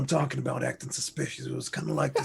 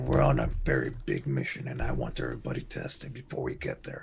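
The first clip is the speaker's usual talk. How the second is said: low, firm captain's voice; commanding captain; 12 semitones lower, 3 dB louder than usual. Acted. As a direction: give the crew orders from deep in the chest. Captain in-character orders.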